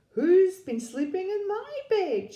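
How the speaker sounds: high, loud Mummy Bear; middle-sized voice. A narrator's reading. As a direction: call it out high, as an affronted mother bear. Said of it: "she said in her middle-sized voice".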